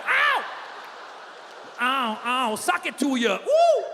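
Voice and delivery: high-pitched exuberant yelps; hammed-up high hype cries. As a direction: whoop it up like a gospel showman.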